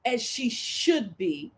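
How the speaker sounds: usual speaking voice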